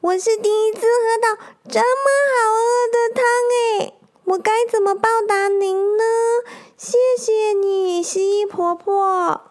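put-on high, gushing childlike voice; a little boy thrilled by the best soup ever; squeaky-high hedgehog, overjoyed; happy hedgehog kid. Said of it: "好开心地说".

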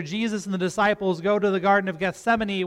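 ordinary talk

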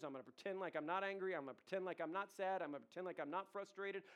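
ordinary talk, nothing marked

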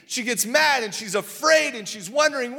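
normal talking voice

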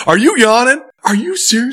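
high-pitched, incredulous coach scolding; acted-out indignant dressing-down; ripping a kid. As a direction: call out a kid in mock outrage. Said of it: "I ripped a kid tonight".

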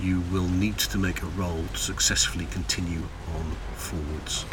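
low voice sinking towards a whisper; hushed scene setting. Acted. Game master describing a chill on the bridge, hushed narration.

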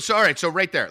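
loud, cutting in; loud interruption. Real, not acted. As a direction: talk over him loudly. Cutting in loudly.

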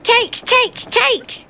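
put-on high excited children's chant; children clamouring. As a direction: beg for cake like eager kids. Children crowd shouting for cake.

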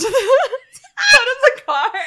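high, loud laugh-shout. Real, not acted. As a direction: burst out laughing mid-sentence. Laughing at skeleton scene.

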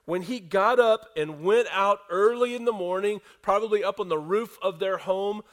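loud, high, driving sermon delivery; storming pulpit voice; full-force preaching. Acted. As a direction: preach it out to the whole room.

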